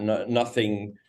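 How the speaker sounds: ordinary talk, nothing marked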